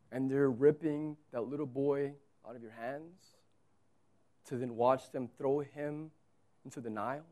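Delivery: soft, low, grave narration; hushed storytelling. Acted. Preacher asks listeners to imagine the mothers, soft and low. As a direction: draw them in quietly with grief.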